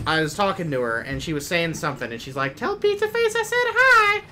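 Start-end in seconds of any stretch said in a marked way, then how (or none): 2.5-4.2 put-on a terrible Texas accent sending a greeting; Amy's Texas accent. Streamer imitating his friend's greeting in a bad, much higher Texas accent. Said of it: "That's my Amy voice".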